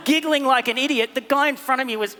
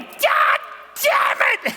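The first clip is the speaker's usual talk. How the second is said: yelled God damn it in a pitch jump; frustrated customer cursing at the queue; angry queue shout; slower than usual. Retold or imitated. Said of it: "Standing there going".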